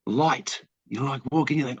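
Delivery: usual speaking voice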